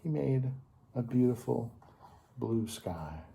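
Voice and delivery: ordinary delivery, nothing marked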